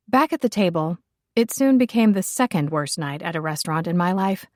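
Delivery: ordinary delivery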